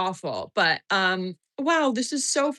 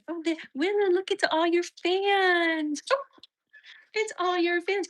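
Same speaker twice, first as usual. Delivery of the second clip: doting pet-parent coo; 9 semitones higher than usual. Real, not acted. Owner showing her dog Willa all the fans, high doting coo.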